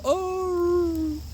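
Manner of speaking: half-hearted high wolf howl, held back; small wolf howl. Shown as a demonstration. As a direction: give just a little howl, not your all. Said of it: "just a little small howl".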